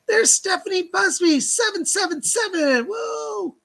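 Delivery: high, excited sing-out ending in a woo; excited shout-out. Real, not acted. Medium hails Stephanie's donation on the livestream, excited shout-out. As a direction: cheer the name and numbers in a high voice. Greeting a viewer's donation live.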